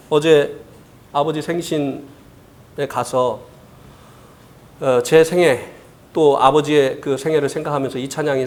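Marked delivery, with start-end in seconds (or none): none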